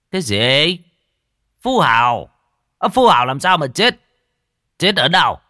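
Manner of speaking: loud, high, sharp questions; astonished master, voice leaping up; shocked disbelief. Acted. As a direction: demand answers in startled alarm. Master shocked at news of death.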